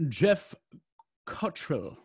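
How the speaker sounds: playful put-on British accent on the name; name read out with a British lilt. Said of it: "remember to say it in a British accent".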